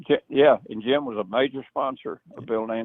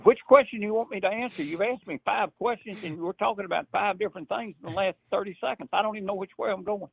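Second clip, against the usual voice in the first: exasperated; 9 semitones higher than usual. Retold or imitated. Overwhelmed reply to rapid questions.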